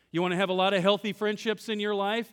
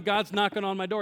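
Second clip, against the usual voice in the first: ordinary talk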